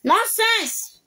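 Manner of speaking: indignant word pitched high; high-pitched dismissal. Real, not acted. Dismissing an accuser a second time, high and indignant. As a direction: throw it out high in the voice. Repeats the dismissal much higher.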